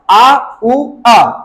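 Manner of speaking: आ उ अ as three slow joined vowels; teacher sounding out all three vowels in आ उ अ; triphthong. Shown as a demonstration. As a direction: glide slowly through आ उ अ. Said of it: "इसको बोलेंगे ट्रिप्थोंग्स".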